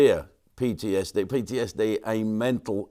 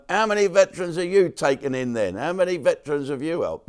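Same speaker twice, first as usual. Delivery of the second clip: mocking critics; 7 semitones higher, 3 dB louder than usual. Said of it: "the odd said".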